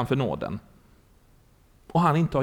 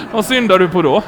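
loud retold retort; emphatic punchline; 3 semitones higher, 8 dB louder than usual. Preacher repeats pastor's retort after the laugh, loud and emphatic. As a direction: throw the question out again, louder.

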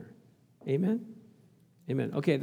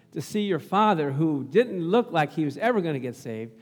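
normal talking voice

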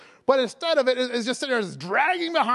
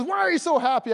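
higher-pitched imitation of an amazed clerk; insistent astonished question; 7 semitones higher, 4 dB louder than usual. Young clerk pressing the shopper, amazed and insistent. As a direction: ask it eagerly, voice lifted.

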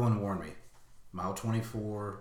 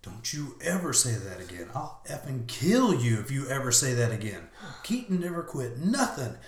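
scolding yourself, building higher and louder; rage and anger; 5 semitones higher, 5 dB louder than usual. Retold or imitated. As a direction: start firm, build to a raised threat. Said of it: "I just filled with rage and anger".

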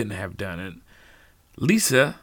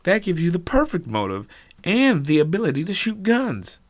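heated accusation; 6 semitones higher, 5 dB louder than usual. Acted. Norman accuses Lisa.

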